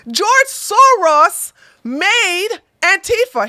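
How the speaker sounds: slow, loud emphatic blurt; overexcited claim. Real, not acted. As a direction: get carried away with it. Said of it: "I got too excited".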